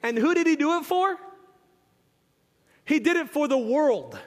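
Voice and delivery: ordinary delivery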